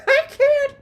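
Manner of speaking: breaks into a high laugh on the words; gasping through real laughter; helpless giggle, can't get it out. Real, not acted. Cracking up at the chat.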